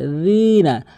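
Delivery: "রিনা" begun with soft dh, as in 'this'; correct soft dhal. Shown as a demonstration. Teacher showing the dhal, soft dental pronunciation. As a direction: put tongue tip to teeth for "রিনা". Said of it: "জিহ্বার আগে দাঁতের আগে".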